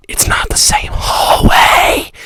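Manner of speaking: voiceless, strained and drawn out; hammed-up raspy exclamation. Storyteller revealing a twist in the chase, slow raspy exclamation. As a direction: stretch it out in a rasping stage shout.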